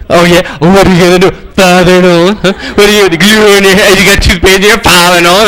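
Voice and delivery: relentless mocking bully, phrase piled on phrase; taunting. Bully taunting Nolan as he pokes him, mocking. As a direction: keep needling him in a mock bully voice. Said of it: "he kept taunting me".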